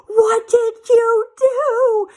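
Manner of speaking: high, drawn-out mock wail; tearful outcry. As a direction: wail it slowly like a horrified boss about to cry. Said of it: "And she started to cry".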